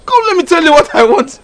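high, loud coaxing call; calling her back. Acted. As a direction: reach her across the room.

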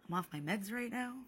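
ordinary talk

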